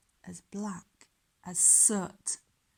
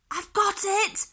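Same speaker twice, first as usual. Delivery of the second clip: much higher and louder, sudden inspiration; excited cry; 11 semitones higher, 5 dB louder than usual. Acted. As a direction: blurt it out as the idea strikes. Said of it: "she cried".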